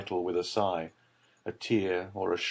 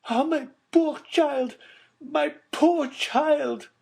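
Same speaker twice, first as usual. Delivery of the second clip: much higher, slow, louder anguished cry; crying mother; 6 dB louder, much slower than usual. A narrator's reading. Baroness mourning her lost child, crying out. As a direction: wail it out like a broken mother. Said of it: "cried the Baroness".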